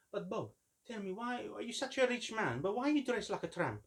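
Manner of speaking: dad teasing. Retold or imitated. Dad teases [name] in a high, put-on voice. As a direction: rib a rich friend in an imitated dad voice. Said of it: "My dad used to say to him".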